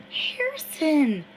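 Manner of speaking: ordinary delivery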